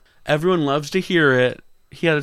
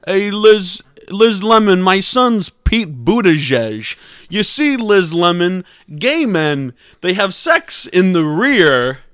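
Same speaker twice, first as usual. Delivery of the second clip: drawn-out caricature voice; slow down on the key words, in character; hammed-up impression; 5 semitones higher, 6 dB louder, slower than usual. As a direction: comedian impression, slightly raised. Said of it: "all these impressions going on".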